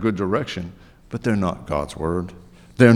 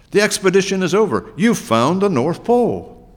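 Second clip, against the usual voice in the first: high-pitched excited announcement; 9 semitones higher than usual. A narrator's reading. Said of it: "Christopher Robin said excitedly".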